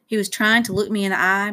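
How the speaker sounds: ordinary delivery, nothing marked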